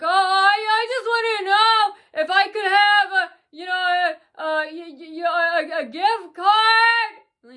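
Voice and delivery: high, loud, crying sing-song plea; mock whiny sing-song. Mocking a man asking for a gift card in a wailing singsong. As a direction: whine it out like a sobbing child. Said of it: "so he's like".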